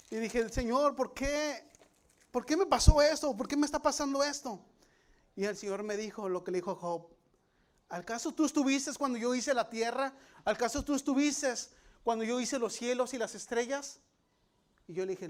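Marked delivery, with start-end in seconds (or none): 0.5-4.6 high, loud, pleading cry to God; anguished outcry. Retold or imitated. A man shouting at God about his suffering, high and loud. 7.9-14.0 a touch higher and louder, challenging; lightly voiced God. God answering the complaining man, a hint of stern challenge.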